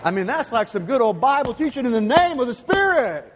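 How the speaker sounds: high, loud revival-preacher cry; hammed-up preacher shout. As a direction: belt it out like a fired-up preacher, playfully. Playful burst of revival-style preaching.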